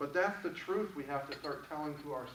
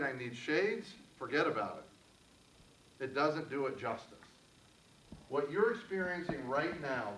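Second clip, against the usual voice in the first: calm, gentle guided-exercise voice; guided meditation. Acted. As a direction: lead the room into stillness, unhurried. Leads audience in guided exercise.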